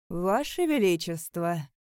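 loud, drawn-out mock reverence; sneering ceremonial address. A narrator's reading. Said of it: "насмешливо произнёс он".

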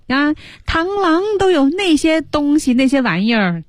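high-pitched mock pouting; coquettish. Teacher acting out the envious line in Chinese, coquettish. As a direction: whine it high like you're acting cute. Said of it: "撒娇".

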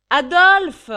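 hurlé bien plus haut et fort; appel crié. Acted. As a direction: appelle Ragdal en criant. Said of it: "appela la môme". (cri aigu et très fort)

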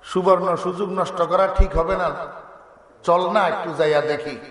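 low-pitched royal musing; acted king, pitched well down. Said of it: "বাদশা কহ".

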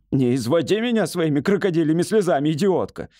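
high, exasperated scolding; shouting. A narrator's reading. Patient shouts down tearful wife, exasperated. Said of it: "закричал на неё Бедекер".

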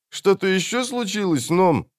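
high-pitched, inquiring voice; assassin's friend. A narrator's reading. Friend asks the assassin what else happened, high-voiced. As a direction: ask it well up in pitch.

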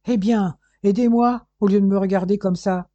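irritated growl of a command; grumbling. A narrator's reading. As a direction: grumble it at them, annoyed and loud. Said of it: "grommela-t-il".

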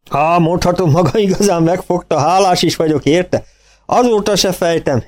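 farmer voice. A narrator's reading.

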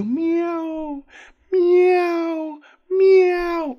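long, high, drawn-out meow; imitated cat meow. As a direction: mimic a cat's slow, eerie meows. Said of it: "nó vừa kêu như vậy đó".

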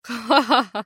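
acted mocking laugh; short gloating "ha-ha-ha"; villain's guffaw. A narrator's reading. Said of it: "قهقه النحيل ضاحكا".

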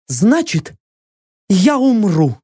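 defiant warrior woman. Acted. Captive woman's voice.

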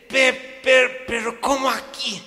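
high, loud bewildered cry; grasping for words; shocked husband sputtering; stunned blurt. Retold or imitated. Husband stammering to wife.

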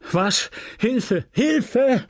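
high-pitched cry for help; alarmed cry. A narrator's reading. Husband discovers the poisoning, alarmed cry. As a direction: call out in panic, pitch leaping.

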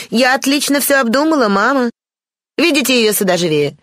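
put-on high-pitched haughty young lady; lofty, self-willed voice, pitched well up; Бланш. A narrator's reading. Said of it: "раздался надменный голос Бланш". (высокий надменный голос барышни)

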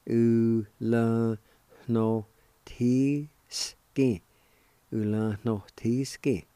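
"Ulanowski" said slowly, each syllable drawn out; slow demo. Shown as a demonstration. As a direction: stretch "Ulanowski" for learners to repeat. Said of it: "it's pronounced like this".

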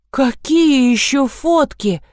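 high, drawn-out outraged question; mild yell. A narrator's reading. Lida to Masha about photos, mildly yelling. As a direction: cry out slowly in disbelief, without full volume. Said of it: "заорала Лида".

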